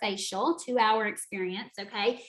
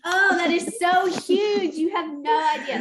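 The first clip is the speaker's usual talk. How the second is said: excited gushing; 6 semitones higher, 5 dB louder than usual. Real, not acted. Celebrating award.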